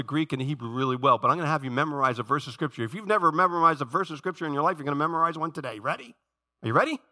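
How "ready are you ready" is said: raised, higher-pitched check on the crowd; rallying question. Real, not acted. Preacher asking church to memorize a verse, rallying question. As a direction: ask brightly, pitched up for an answer.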